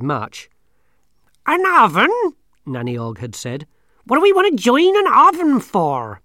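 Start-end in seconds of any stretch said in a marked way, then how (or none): 1.5-2.1 put-on old woman voice. Nanny mishears coven. 4.0-6.2 put-on old woman voice. Nanny Ogg voiced.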